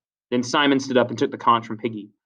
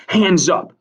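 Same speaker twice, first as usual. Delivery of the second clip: loud, raised-pitch order; strong command; 7 semitones higher, 7 dB louder than usual. A narrator's reading. Jack demands a show of hands, strongly. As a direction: order it forcefully to the group. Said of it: "said Jack strongly".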